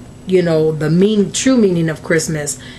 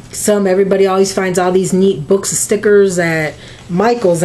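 normal talking voice

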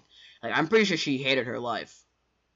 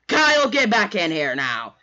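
slow, loud, raised command; acted angry teacher calling out; teacher's yell; 6 semitones higher, 8 dB louder than usual. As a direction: shout to order a runaway pupil back. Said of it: "the teacher was like".

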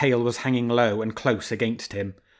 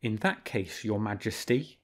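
murmured; 7 dB quieter than usual. A narrator's reading. Fox murmuring slyly to the king. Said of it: "murmured Renard".

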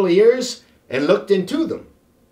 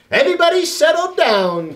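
raised voice over the class; teacher calling out; 9 semitones higher, 4 dB louder than usual. A narrator's reading. Teacher quieting noisy children, raised call. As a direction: call the room to order. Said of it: "cried Miss B".

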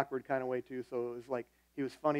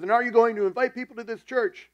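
acting out an irate boss yelling; high, very loud, confrontational question; angry demand; 8 semitones higher, 10 dB louder than usual. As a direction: shout it as an ultimatum, imitating a furious boss.

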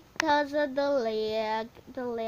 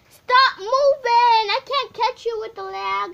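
frustrated yell; 10 semitones higher, 16 dB louder than usual. Real, not acted. Yells at sheep through lag.

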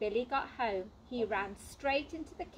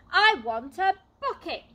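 put-on insistent child; 8 semitones higher, 12 dB louder than usual. A narrator's reading.

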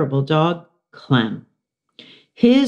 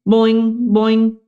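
high bouncy boing boing; hamster boing; 6 semitones higher, 4 dB louder than usual. Acted. Hamster imitating Og's bouncy boing. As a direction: bounce the words up high like a hopping frog. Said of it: "like that magician called Houdini".

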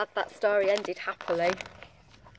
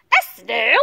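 loud, rising disbelief; shocked teacher; 10 dB louder, slower than usual. A narrator's reading. Teacher spots the snail in class, shocked and loud. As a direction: question it loudly, aghast. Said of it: "The teacher turns pale".

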